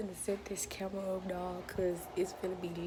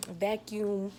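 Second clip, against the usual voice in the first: usual speaking voice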